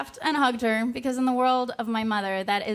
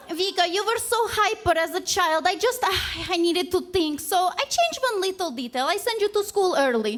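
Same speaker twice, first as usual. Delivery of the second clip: higher, breezy mock mother's voice; imitating mom; 7 semitones higher than usual. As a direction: play mom shrugging it off, pitched up. Mother explains the birth date.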